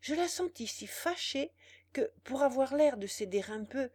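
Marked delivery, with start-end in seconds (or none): none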